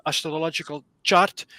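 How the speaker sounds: usual speaking voice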